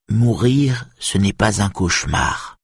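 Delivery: plain reading voice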